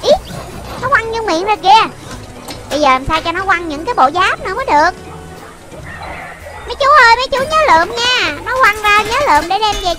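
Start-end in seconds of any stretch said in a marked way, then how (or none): none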